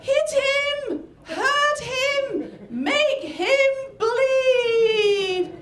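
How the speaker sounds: very high, loud ringside screech, last word drawn out; bloodthirsty yelling. Retold or imitated. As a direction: yell like little old ladies baying for blood. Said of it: "their owners were yelling things like".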